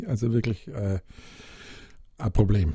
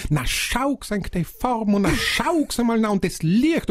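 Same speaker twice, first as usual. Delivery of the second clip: imitating mom; 11 semitones higher than usual. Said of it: "weil die Mama vorne im Auto".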